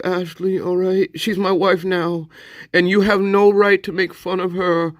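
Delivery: high put-on voice of a scolding frontier dad; hammed-up folksy character. Mock TV dad scolding him about the Valium, high put-on voice. Character defending his wife Ashley.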